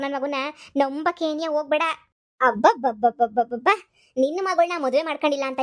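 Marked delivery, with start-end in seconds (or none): 2.4-3.7 drawn-out mocking lip babble, lower and louder. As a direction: sneer at her with a long babble. Mock astonishment at the rival.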